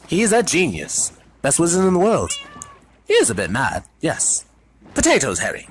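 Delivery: high, loud breezy prefect; airy. A narrator's reading. Percy dismisses Harry's worry about Dumbledore, airily. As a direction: toss it off lightly. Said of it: "said Percy airily".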